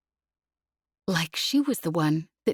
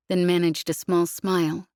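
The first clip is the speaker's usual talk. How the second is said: usual speaking voice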